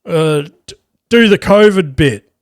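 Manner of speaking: lightly voiced drunk guy calling out a request; a hint of a tipsy punter shouting from the crowd; drunk heckler. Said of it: "this drunk dude".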